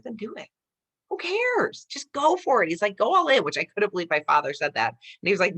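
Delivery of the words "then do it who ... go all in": rising, pushed-up urging, ending louder; higher voice growing louder to go all in; dad's encouragement. Retold or imitated. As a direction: egg her on like a fired-up dad. Dad cheering her on.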